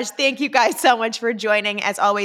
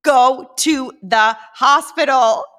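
louder, slow and firm, every word pressed; all caps; 3 semitones higher, 7 dB louder, slower than usual. Retold or imitated. Daughter reads her all-caps text to mom, loud and insistent. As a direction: read it like it's typed in capitals. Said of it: "all in caps".

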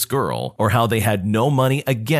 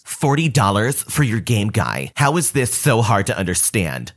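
put-on voice of a pushy entitled mom; entitled Karen. Narrator voicing the Karen haggling for the Game Boy, put-on pushy voice. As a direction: play the pushy mother lowballing a kid. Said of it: "She then says".

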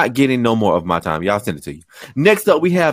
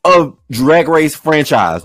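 ordinary talk, nothing marked

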